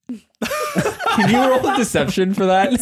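high, slow, laughing through the words; laughing request. Real, not acted. Game master asking for a deception roll, cracking up. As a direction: barely get the question out for laughing.

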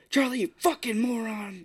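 high mock shout of the insult; hammed-up dad shouting at his daughter; imitating a yell. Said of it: "yelling at this kid".

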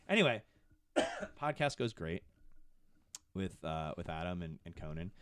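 normal talking voice

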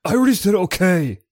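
fed-up retort. Acted. Host imagines answering a repetitive boss, fed up. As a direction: snap it at a boss who repeats himself. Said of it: "he just repeats himself nonstop".

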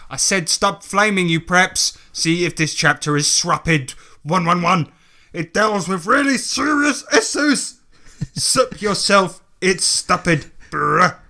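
mock a defensive writer's misspelt outburst; halting, exaggerated indignant note; put-on author's-note rant.

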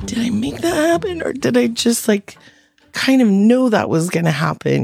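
high, wondering retold question; incredulous inner voice, starting high; retold self-questioning. Retold self-questioning after witnessed crash.

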